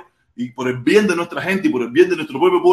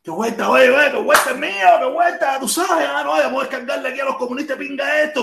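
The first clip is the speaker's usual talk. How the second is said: high, breezy fake-casual voice; high mock-innocent chatter; playing dumb; 9 semitones higher than usual. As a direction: sound harmless before the attack. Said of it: "me voy a hacer el bobo".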